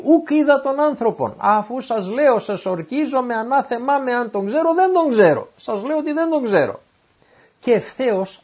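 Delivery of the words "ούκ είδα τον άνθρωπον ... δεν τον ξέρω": high, insistent acted protest; cursing, swearing denial. Peter cursing and swearing before his accusers, insistent high-pitched denial. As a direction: protest on oath, pitched well up. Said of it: "άρχισε να αναθεματίζει και να ορκίζεται".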